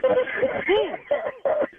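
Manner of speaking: high laugh of relief; relieved laugh. Real, not acted. Pranked husband to radio host, high relieved laugh. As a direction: laugh it off after being pranked.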